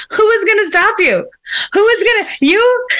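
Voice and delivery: a mock stern mom, high and incredulous; serious mom. Mom to daughter, deadpan and incredulous. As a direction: play the mom who knows her kid can't be stopped. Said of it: "in the most serious face and she goes".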